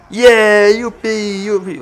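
high, loud yippee tailing off; hammed-up burst of glee; mock cheering. Player cheering after losing two men, mock glee.

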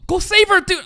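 high, loud cry to a character; urgent yell. Real, not acted. Viewer urging a character to the rescue, urgent yell. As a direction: yell at him to act, pitched high.

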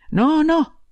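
a bride quickly keeping her husband at a distance; alarmed no. A narrator's reading. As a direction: fend him off with a frightened no. Bride pushes husband away.

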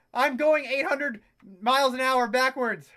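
normal talking voice

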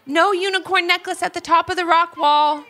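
calling out. A narrator's reading. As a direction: call it down from the wall top. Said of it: "called out Levi".

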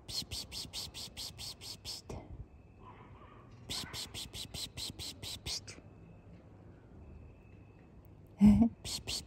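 rapid, soft, voiceless "psst" calls; cat-calling hiss. Real, not acted. Woman luring kittens to look at her, cat-calling psst. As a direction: coax a shy cat with little hisses.

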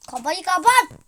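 loud high yell; shouting. Real, not acted. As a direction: shout across the room. Yelling at sibling.

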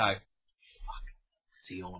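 hushed, breathless aside of an impressed guy; whispered like a guy muttering to his mate; mock whisper. Guy at the bar whispering about the dancer, mock hush. Guys reacting, voiced by S1.

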